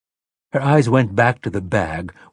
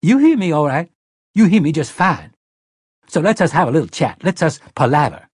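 much higher, louder, taunting grin; grinning Detta; 7 semitones higher, 6 dB louder than usual. Acted. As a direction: needle her with a grin. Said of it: "Her grin widened".

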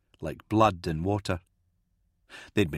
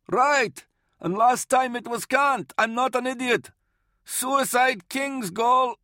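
high-pitched shouted retort; defiant calling out; 12 semitones higher than usual. Acted. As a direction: argue stubbornly through a closed door. Varl shouts back from behind hatch.

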